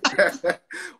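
high loud laugh; burst of laughter. Real, not acted. Guest laughing with the host, high and bright.

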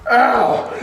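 ordinary delivery

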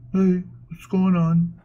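a light, slow, mopey Eeyore take on the dog; lightly voiced gloomy donkey begging for pets. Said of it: "Gunther just walks around".

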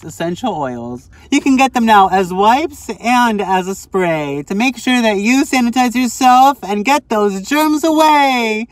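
high, playful vlog ad read; peppy send-up of an ad; mock sponsor read. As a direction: sell it like a jokey sponsor plug, ending on a big cheer.

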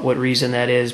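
normal talking voice

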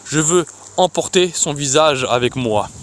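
ordinary delivery, nothing marked

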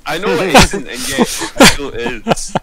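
high, loud, mock-guilty protest; guilty outburst. Real, not acted. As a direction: blurt it out as if caught. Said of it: "said it so accusationally".

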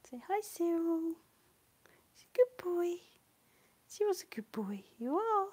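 high whispery voice for the dog; doggy voice. Real, not acted. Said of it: "I've gone in my doggy voice".

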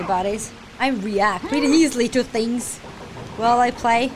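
ordinary talk, nothing marked

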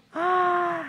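high, breath-in gasp of wonder; gasp, stunned by the detail; amazed gasp. Real, not acted. Vlogger seeing the doll's nails, amazed gasp.

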